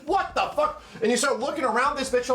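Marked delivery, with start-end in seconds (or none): 0.0-0.8 startled. Retold or imitated. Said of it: "you're like".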